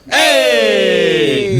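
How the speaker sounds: shout. Real, not acted. Shouting at the foreman.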